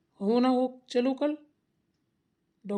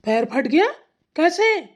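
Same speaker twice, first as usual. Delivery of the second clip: louder, rising startled question; surprised; 5 semitones higher, 8 dB louder, slower than usual. Acted. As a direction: react in alarm to the hurt foot. Son reacts to mother's injury. (हैरानी से पूछना)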